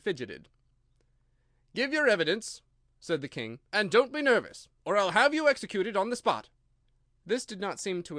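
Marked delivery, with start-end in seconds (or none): 1.7-2.6 higher, louder stern King; a king scolding his subject; loud reproving royal voice. A narrator's reading. King commands. 3.7-6.5 higher, louder stern King; a king scolding his subject; loud reproving royal voice. A narrator's reading. King threatens.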